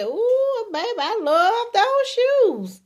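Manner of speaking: high, drawn-out delighted compliment; admiring gush. Acted. Man admiring his woman's nice heels, admiring gush. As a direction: savor it slowly with an appreciative ooh.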